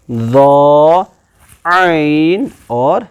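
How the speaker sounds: ضا and عین held long from the throat; drawn-out letter names. Shown as a demonstration. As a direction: stretch the letters slowly. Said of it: "ایسے ٹپ باہر یہاں پر".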